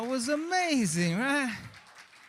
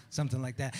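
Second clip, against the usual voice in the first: ordinary talk, nothing marked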